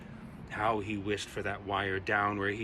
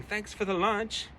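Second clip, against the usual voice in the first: gleeful call; 11 semitones higher than usual. A narrator's reading. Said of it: "he flew away screaming".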